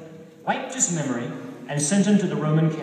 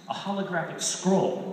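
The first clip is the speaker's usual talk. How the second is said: ordinary delivery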